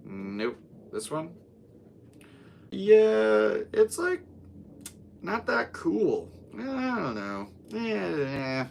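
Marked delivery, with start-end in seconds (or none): 2.7-8.7 put-on high, drawn-out Valley Girl voice. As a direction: play a doubtful Valley Girl, stretching the words up high. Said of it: "I did that in the Valley Girl voice".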